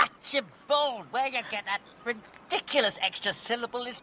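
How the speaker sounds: high indignant shout of the name; mocking shout. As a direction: bellow the name back at her in mockery. Mocks her way of saying it.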